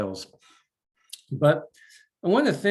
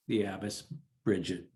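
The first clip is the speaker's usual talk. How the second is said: ordinary delivery, nothing marked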